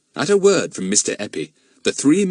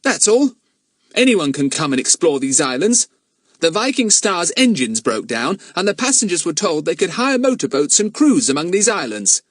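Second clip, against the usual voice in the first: put-on much higher, louder boy's voice; boy Jack; 10 semitones higher, 4 dB louder than usual. Jack explaining himself to Mister Eppie, boyish protest. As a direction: answer as a high-voiced, defensive boy. Young Jack's character voice.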